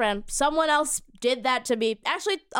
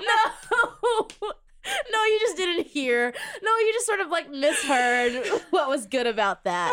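laughing; 7 semitones higher, slower than usual. Real, not acted. Defending her impression, laughing.

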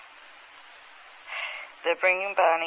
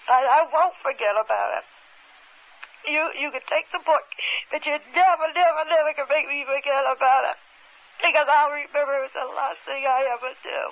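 higher, louder, insistent vow that she'll never forget; reliving the outrage, pitch climbing; furious defiance; 8 semitones higher, 4 dB louder than usual. Retold or imitated. Woman defying the leader who took her proof, furious high protest. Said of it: "Oh, I'm furious".

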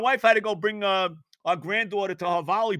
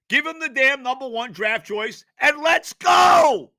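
furious demand to the front office; exasperated shout; 6 semitones higher, 7 dB louder than usual. Real, not acted. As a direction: yell it, louder and higher, fed up. Exasperated rant at team.

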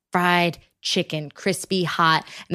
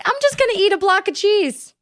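silly high voice; 11 semitones higher than usual. Pretend. Joking inner voice quote.

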